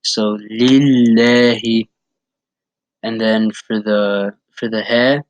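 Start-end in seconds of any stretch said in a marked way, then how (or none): 0.5-1.9 "Lillahi" slowly, with the lam held as if said twice; doubled lam. Shown as a demonstration. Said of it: "you stress it".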